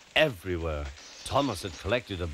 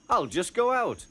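cheeky plotting engine; 12 semitones higher than usual. Acted. Thomas plotting mischief.

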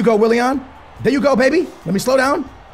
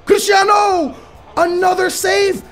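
very high, loud shouting; frantic goal call; 10 semitones higher, 3 dB louder than usual. Real, not acted. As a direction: scream the goal as it goes in. Goal after a save.

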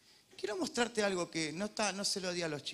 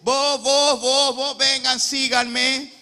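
loud, slow summons; Jesus calling out loudly across the shore; 8 semitones higher, 14 dB louder, much slower than usual. Acted. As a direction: call each disciple to follow.

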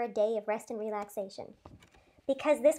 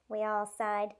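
ordinary delivery

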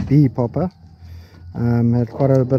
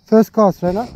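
called out loud; 6 semitones higher, 3 dB louder than usual. Real, not acted. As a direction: call it out to your mate, higher and louder. Calling cast to fishing partner.

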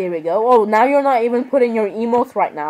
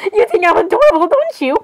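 high, giddy teasing; playful gushing; 7 semitones higher than usual. Real, not acted. Fangirl teasing a cute character, high playful gushing. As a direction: coo it high, climbing on the question.

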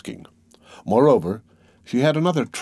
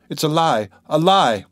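raised, higher protest, building louder; heated denial; 4 semitones higher, 4 dB louder than usual. A narrator's reading. Dunya defends her brother against the accuser, heated denial. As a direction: throw the accusation back with rising force.

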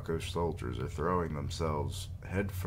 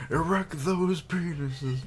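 higher, slow, pompous command; mock grand order; 12 semitones higher, 4 dB louder, slower than usual. Gamer mocking the misprinted order, high and slow. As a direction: deliver the joke line like a general. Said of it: "it says erect".

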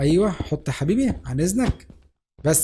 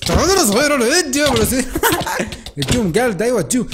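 excited taunt; 3 semitones higher than usual. Real, not acted. Fighting, taunting enemy.

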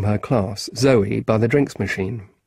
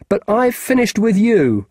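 defiant retort; 10 semitones higher, 4 dB louder than usual. Acted. Boy defiant to teacher.

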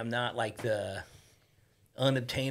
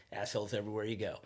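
quick, softer dismissive remark; imitated aside; 2 semitones lower, 6 dB quieter, faster than usual. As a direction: toss it off to your companions, meant to be overheard. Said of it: "loud enough to where the people he was sitting with".

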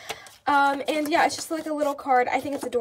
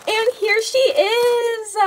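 excited reveal; 5 semitones higher, 4 dB louder, much slower than usual. Real, not acted. Big reveal of new plush.

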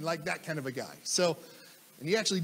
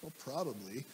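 reluctant mumble; 7 semitones lower, 12 dB quieter than usual. Retold or imitated. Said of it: "reluctant answers to Charlie".

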